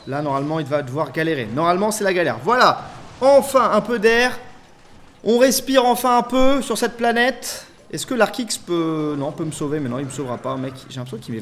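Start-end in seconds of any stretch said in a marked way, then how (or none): 2.4-7.7 high, loud, emphatic exclamation; exasperated relief, drawn out at the end. Real, not acted. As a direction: proclaim relief at the top of your voice. Relief after a hard defense.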